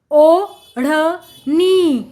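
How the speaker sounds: ओढ़नी very slowly, as ओ … ढ़ … नी; syllable by syllable. Shown as a demonstration. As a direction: separate and stretch each syllable of ओढ़नी. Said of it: "जोड़कर पढ़ेंगे".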